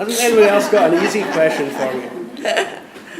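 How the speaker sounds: laughing plea. Real, not acted. Jokingly pleads to the audience.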